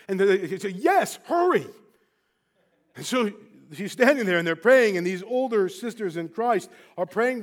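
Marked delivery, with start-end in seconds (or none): 0.7-1.7 high, loud urging; retold urgent plea. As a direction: imitate a desperate mother hurrying them on. Distraught mother urges prayer.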